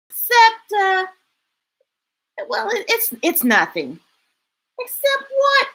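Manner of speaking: chatty friends on an adventure; happy characters. Acted.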